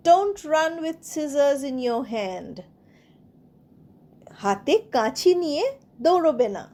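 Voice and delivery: ordinary talk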